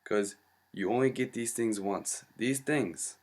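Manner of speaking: hammed-up Jamaican doctor offering good medicine; Rasta doctor. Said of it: "Rasta guy".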